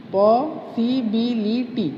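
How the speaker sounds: "Possibility" slowly, पॉ, सि, बि, लि, टी; each beat of "possibility" separated; syllable by syllable. Shown as a demonstration. Teacher repeating possibility for students, slowly split up. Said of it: "four syllables".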